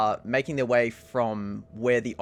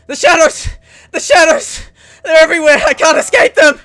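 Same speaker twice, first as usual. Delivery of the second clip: high, very loud panicked screams; screaming in terror; 18 dB louder than usual. Acted. Game master as woman running down the hallway, screaming. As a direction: shriek it as if fleeing for your life.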